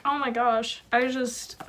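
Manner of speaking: ordinary delivery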